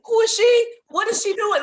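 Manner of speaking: high, loud, nosy questioning; mock the astonished people peeping in, voice shooting up; imitating baffled onlookers. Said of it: "people were peeping in like".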